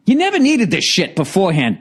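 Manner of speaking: ordinary talk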